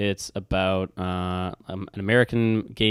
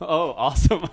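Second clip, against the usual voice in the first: high, laughing delight; 7 semitones higher than usual. Real, not acted. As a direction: laugh through it. Laughing reaction.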